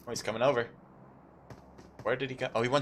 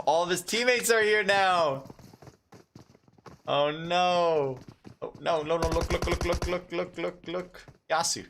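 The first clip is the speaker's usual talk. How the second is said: excited; 6 semitones higher than usual. Real, not acted. Caster sees teammates arrive.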